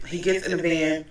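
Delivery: ordinary talk, nothing marked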